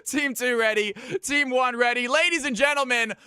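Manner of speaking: high, loud shouted announcer hype; hype match announcer. Acted. Streamer opens the game like an arena announcer, shouting. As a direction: pump up the crowd like a caster.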